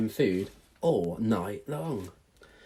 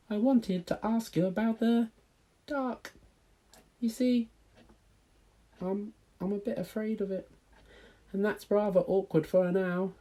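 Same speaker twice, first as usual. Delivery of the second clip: high little owlet asking; squeaky young owl question; baby owl voice; 11 semitones higher than usual. Acted. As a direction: pitch it way up like a small owl chick. Plop confesses his fear.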